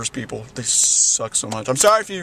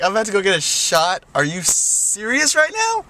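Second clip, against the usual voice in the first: shocked disbelief; 6 semitones higher than usual. Retold or imitated. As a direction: act out the disbelief at needing a shot. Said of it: "I'm over here going like".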